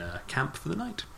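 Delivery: usual speaking voice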